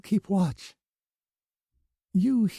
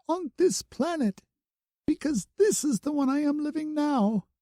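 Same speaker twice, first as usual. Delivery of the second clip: high, earnest through tears; tearful insistence. A narrator's reading. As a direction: plead that only now matters. Bloom pleading, crying.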